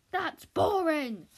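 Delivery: loud, drawn-out dismissal. Acted. Director dismissing Andy's opening, loud and slow. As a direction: drag out the scorn.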